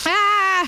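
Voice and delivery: startled yelp. Real, not acted. Cooking mishap.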